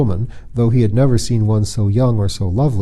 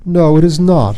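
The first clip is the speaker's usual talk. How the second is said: sulky; 5 semitones higher, 6 dB louder than usual. Acted. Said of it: "he said sulkily".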